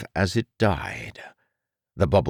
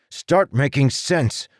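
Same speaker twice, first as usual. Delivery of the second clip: higher, slow and firm demand; 10 semitones higher, 4 dB louder than usual. Acted. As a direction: insist he make sense. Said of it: "the boy demanded".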